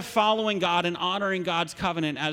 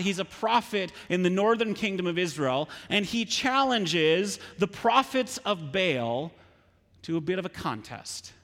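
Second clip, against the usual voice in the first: ordinary talk, nothing marked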